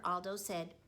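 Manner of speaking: usual speaking voice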